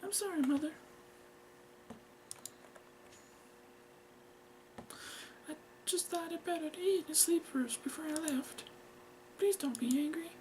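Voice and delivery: put-on high, soft girlish voice; meek daughter. Girl asks to speak to her mother, meek. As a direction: plead meekly, small and high.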